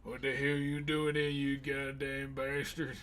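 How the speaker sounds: playful put-on southern twang; southern accent. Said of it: "with that southern accent".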